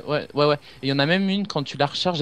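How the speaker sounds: ordinary talk, nothing marked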